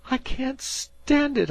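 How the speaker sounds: high, loud and slow, near tears; anguished cry. A narrator's reading. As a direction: cry out that it's too much. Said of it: "cried Dan".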